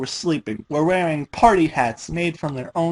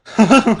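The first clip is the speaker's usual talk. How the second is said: loud nervous burst of laughter; hysterical laugh; 8 semitones higher, 9 dB louder than usual. A narrator's reading. Dash laughs off her terror, loud and hysterical. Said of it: "in a voice bordering on hysteria".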